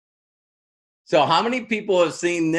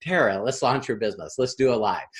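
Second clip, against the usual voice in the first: low, soft, patient push; steady and low, coaxing her along; calm insistence; 5 semitones lower, 3 dB quieter than usual. Retold or imitated. Coach calmly urges Tara to launch, low and patient. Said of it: "And I'm going".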